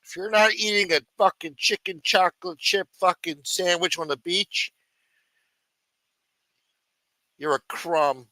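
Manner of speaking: mimicking the clip. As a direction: parrot the ranting vlogger's insult. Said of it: "Maybe I should start talking like that".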